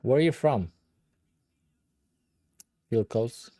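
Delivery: ordinary talk, nothing marked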